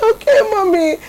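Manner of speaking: put-on high, whimpering child's okay; tearful little boy. Son tearfully agreeing with his mother, high and small. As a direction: give in like a teary kid.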